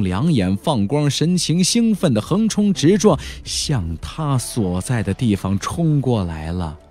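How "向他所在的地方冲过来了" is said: ordinary delivery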